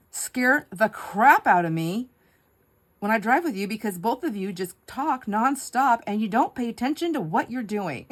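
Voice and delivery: loud, high, emphatic complaint; hammed-up scolding; mock exasperated. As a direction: rant like an exasperated husband. Husband's retold complaint, acted out.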